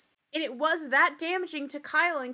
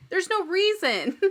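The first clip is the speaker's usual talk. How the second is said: ordinary talk, nothing marked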